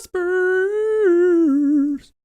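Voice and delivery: very high squeaky voice. Pretend. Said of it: "silly high calls".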